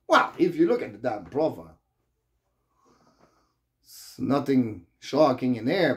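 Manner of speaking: usual speaking voice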